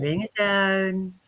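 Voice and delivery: slow, calming "Bring it down"; slow soothing. Retold or imitated. Calming a frazzled friend, slow and soothing. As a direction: draw it out to calm them. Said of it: "fluff up their aura and say".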